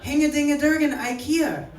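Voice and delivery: high, loud mock-Icelandic gibberish; badly faked Icelandic, played for laughs; fake Icelandic accent. Comic pretending to be from Iceland, fake Icelandic accent.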